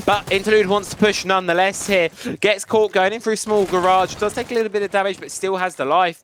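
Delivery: loud, projected esports commentary; urgent play-by-play. Real, not acted. Caster calling Interlude caught in small garage, loud play-by-play. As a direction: call the push with full voice.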